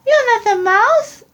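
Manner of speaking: put-on high eager child creature; Gruffalo's child. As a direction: squeak it out like a curious young monster. Child questions the fox.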